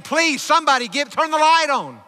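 high, loud plea from a preacher; retold desperate yell. Preacher voicing the girl begging for light in her dream, yelling. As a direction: cry out for help as in the retold dream. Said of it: "I started yelling and screaming and crying and saying".

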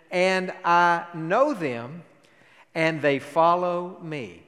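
slow scripture quote. Acted.

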